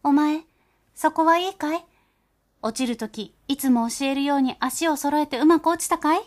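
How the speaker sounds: high, loud worried call into the cello. Acted. Mother mouse calls out to her child inside the cello. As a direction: shout anxiously down to the child. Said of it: "子供に叫びました".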